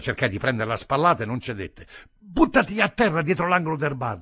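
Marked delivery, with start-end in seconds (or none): 2.2-4.2 raised, urgent command; urgent shout under gunfire; urgent order. Acted. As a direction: bark the order to take cover.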